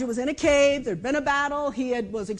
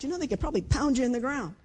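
ordinary delivery

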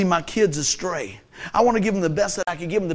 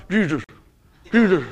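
mock-stern, muffled "Jesus"; trying not to smile; 3 dB louder than usual. Shown as a demonstration. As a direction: say "Jesus" holding back the smile, badly. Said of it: "Can you say Jesus without smiling?".

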